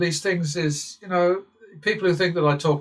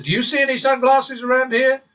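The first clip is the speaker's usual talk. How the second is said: high, loud mock bodyguard demand; retold bodyguard, pitched up and loud; bodyguard's challenge; 11 semitones higher, 7 dB louder than usual. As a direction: play the big bodyguard leaning on him, raised and loud. Bodyguard voiced in retold story.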